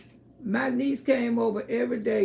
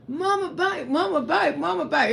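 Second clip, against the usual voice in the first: excited; 5 semitones higher than usual. Retold or imitated. Son calls everybody, excited.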